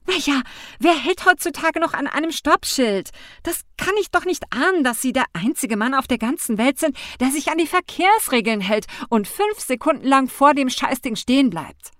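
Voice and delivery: high, loud exasperated rant; rising, indignant tirade; furious and frustrated. A narrator's reading. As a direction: vent at him, hands in the air. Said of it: "Wütend und frustriert".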